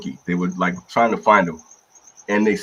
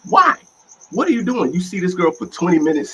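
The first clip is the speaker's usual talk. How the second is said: high-pitched, incredulous questioning; exasperated disbelief; 8 semitones higher than usual. Acted. Fan scolding a Jedi character for his choices, exasperated disbelief. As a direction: demand an explanation, pitch leaping up.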